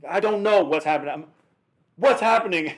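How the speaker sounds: hammed-up exasperated cluelessness; retold bewilderment. Retold baffled answer to cop.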